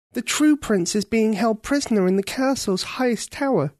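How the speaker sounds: put-on a worried girl pleading her case; young girl Ketcha. Ketcha explains, asks for help.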